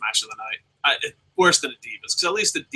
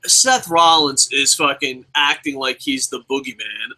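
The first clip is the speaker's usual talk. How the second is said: usual speaking voice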